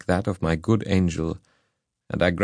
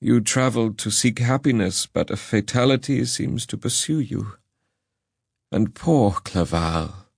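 mournful father; 4 semitones higher than usual. A narrator's reading. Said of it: "looking mournfully".

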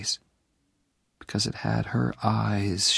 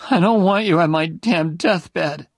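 high, much louder angry outburst; 8 semitones higher, 7 dB louder than usual. Acted. Dying Jenny angrily sends Oliver away, loud and high. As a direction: lash out at him, high and loud. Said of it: "she said angrily".

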